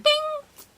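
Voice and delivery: very high, loud sung-out ding; notification ding. Retold or imitated. Storyteller mimics her Tinder notification, high ding. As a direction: imitate a phone alert chime.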